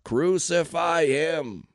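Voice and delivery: drawn-out, loud, high roar; mob roar. A narrator's reading. Mob drowning out Pilate's question, roaring. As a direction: roar it very slowly, stretching each word. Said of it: "the mob roared even louder".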